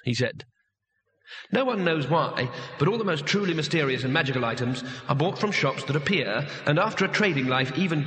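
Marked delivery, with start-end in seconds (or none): none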